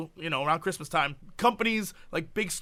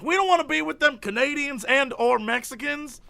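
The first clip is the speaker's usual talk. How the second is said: ordinary talk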